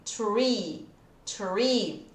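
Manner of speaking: Tree with a normal T, no TH; tree with plain T. Shown as a demonstration. Teacher models tree before showing three. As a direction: contrast tree before adding H. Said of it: "Só que aqui tem um H".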